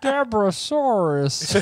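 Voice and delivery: slow, high whiny impression; Ray Romano voice. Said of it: "It's just Ray Romano".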